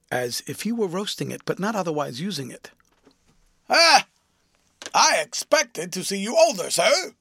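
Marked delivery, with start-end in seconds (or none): 3.7-7.1 sharp, much higher and louder "Ah"; loud disappointed exclamation. A narrator's reading. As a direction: bark out his disappointment.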